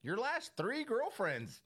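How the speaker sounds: high, loud, pointed questioning; hammed-up incredulous accuser; mock interrogation. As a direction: feign suspicion as you confront the obvious culprit. Playing someone questioning the killer.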